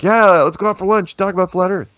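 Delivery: high, loud mock enthusiasm; eager acted reply. Easygoing guy answering students who meet him, eager. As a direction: pitch it way up like a keen invitation.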